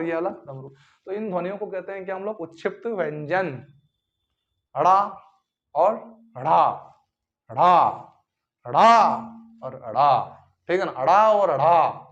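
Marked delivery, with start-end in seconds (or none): 4.7-12.0 अड़ा contrasted, loud flapped ड़; sounding out अड़ा. Shown as a demonstration. Teacher drilling अड़ा for students, loud. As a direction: repeat अड़ा, flap the tongue back hard. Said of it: "अंतर समझ में आ गया होगा".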